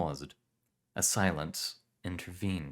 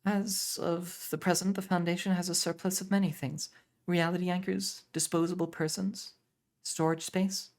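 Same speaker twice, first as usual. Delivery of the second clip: much higher, stiff and formal; stiff young woman; 11 semitones higher than usual. Acted. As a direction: apologize stiffly, high. Aurelia answers formally.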